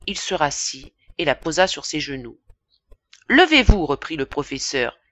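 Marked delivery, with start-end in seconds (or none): 3.3-3.9 higher, louder order; teacher repeating his order; stern command. Acted. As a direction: snap the boy to his feet.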